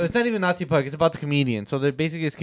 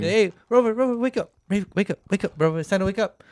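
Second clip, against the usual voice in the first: higher, lightly acted coaxing; owner waking dog; 5 semitones higher, faster than usual. Comedian's voice retold, waking the sleeping dog, higher and coaxing. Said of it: "he's telling the dog".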